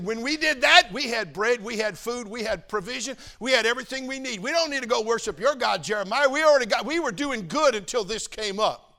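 loud, high mocking boast; defiant crowd. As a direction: shout their smug defiance up at the prophet. People boasting to Jeremiah.

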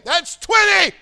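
high, loud yell at the shopper; shout the count. Pretend. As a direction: bellow it down the checkout line. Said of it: "I wanna count for him".

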